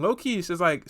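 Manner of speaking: ordinary talk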